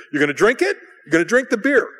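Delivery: insistent questions, climbing high; mock badgering. Brothers pressing a guest to drink, badgering. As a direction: act out relentless peer pressure.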